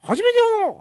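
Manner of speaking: very high, strained mimic voice; 川藤 impression. Co-host answers praise of his mimicry in character, high impression voice. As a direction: retort in a squeezed, high comic impersonation. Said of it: "モノマネを初めて評価されましたね".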